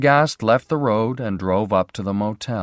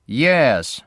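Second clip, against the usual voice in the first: flat and unfriendly; 6 semitones higher, 7 dB louder than usual. A narrator's reading. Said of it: "said a flat, unfriendly voice".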